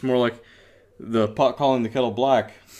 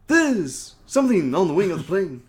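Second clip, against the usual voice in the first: Shatner impression; 6 semitones higher, 3 dB louder than usual. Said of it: "make Shatner sound like some of [name]".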